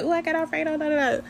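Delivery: high-pitched mocking imitation; mock picky eater. Mimics picky viewers objecting.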